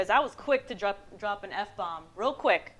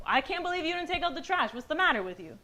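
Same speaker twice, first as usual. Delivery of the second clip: higher, mock exasperated scolding; accusing; 7 semitones higher than usual. Shown as a demonstration. Wife scolding husband over trash, accusing. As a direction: start high in reproach, then drop. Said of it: "it's a direct hit at his character".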